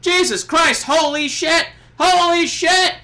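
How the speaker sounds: high, loud ringside yelling; excited fan yelling. Retold or imitated. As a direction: yell like a stunned ringside fan. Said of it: "a fan on the side of the ring".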